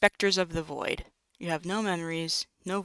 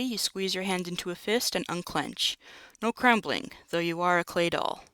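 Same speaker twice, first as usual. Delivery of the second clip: ordinary talk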